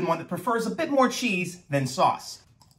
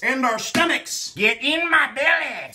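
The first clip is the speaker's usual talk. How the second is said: greedy glutton; 6 semitones higher, slower than usual. Pretend. Hungry host playing it up.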